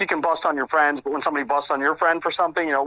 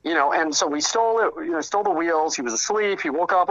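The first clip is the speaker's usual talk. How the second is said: ordinary talk, nothing marked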